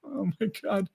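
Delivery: softly stunned, mostly to oneself; retold dismay. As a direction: say it under the voice, dropping away. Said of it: "it's just like".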